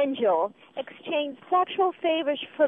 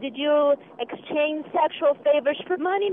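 usual speaking voice

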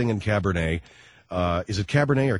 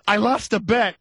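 Liza voice; 9 semitones higher, 5 dB louder than usual. Retold or imitated. Liza and Larry exchange.

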